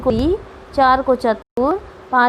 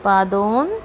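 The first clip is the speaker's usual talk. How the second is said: low repeated answer; 4 semitones lower, 4 dB quieter, slower than usual. Shown as a demonstration. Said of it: "तो पौने चार को लिखेंगे".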